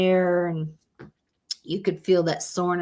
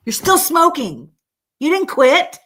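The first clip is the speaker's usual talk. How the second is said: louder, higher, fed-up scolding; exasperated scolding; 8 semitones higher, 6 dB louder than usual. Real, not acted. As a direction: call them out hard. Scolding vapers.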